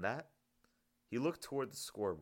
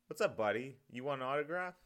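louder, higher breezy call to a fan; friendly greeting; 6 semitones higher, 5 dB louder than usual. Acted. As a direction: toss it over the fence to a kid. Athlete greets a fan at fence.

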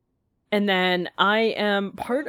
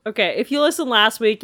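usual speaking voice

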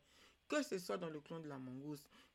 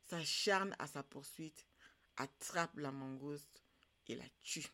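ordinary delivery, nothing marked

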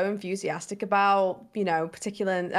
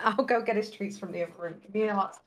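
ordinary talk, nothing marked

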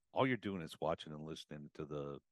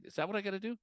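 higher, mock-naive patient voice; much faster than usual. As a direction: play the clueless patient, pitched up. Patient voiced in doctor story.

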